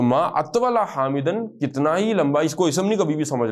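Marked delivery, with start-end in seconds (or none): 0.0-1.6 ما اطول حامدن slowly, Attaul rising; slow repeat. Shown as a demonstration. Teacher repeating the example for students, slow. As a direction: go over the phrase once more, drawn out. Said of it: "اس کا مفعول بنتا ہے".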